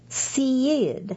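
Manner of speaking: Seed drawn out slowly into two syllables, "SEE-yid"; stretched-out Southern name. Retold or imitated. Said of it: "can stretch out my one-syllable name".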